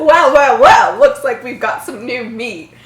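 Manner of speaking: menacing bully. A narrator's reading. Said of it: "bully greeting reread".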